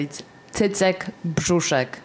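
Cycek Brzuszek said slowly in native Polish, Cycek with ts sounds; proper Polish pronunciation. Shown as a demonstration. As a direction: Polish speaker carefully saying Cycek Brzuszek, unhurried. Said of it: "I'll say it properly".

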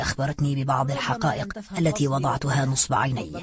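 low, soft, even reading; subdued, measured manuscript voice; written account read out. Acted. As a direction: read like an old author's hushed confession. Reads out the book's text.

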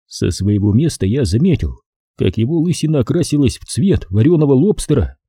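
ordinary delivery, nothing marked

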